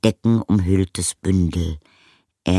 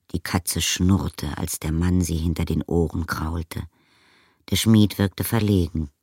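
ordinary delivery, nothing marked

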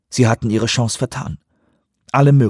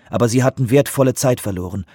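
ordinary delivery, nothing marked

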